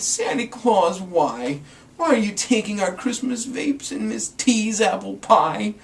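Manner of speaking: put-on high, questioning little child; wide-eyed kid asking why. A narrator's reading. Child questions fake Santa.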